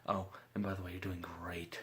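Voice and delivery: ordinary talk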